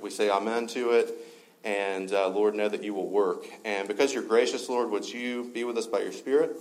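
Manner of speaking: pastor praying over the congregation, hushed; prayer. Acted. Prays aloud before sermon.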